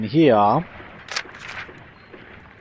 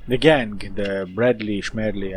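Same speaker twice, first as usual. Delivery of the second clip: usual speaking voice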